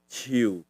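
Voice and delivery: ciu low and falling; tree tone. Shown as a demonstration. Teacher repeats tree for learners, ciu low falling. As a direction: let ciu sink in pitch. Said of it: "kamu dengerin nadanya".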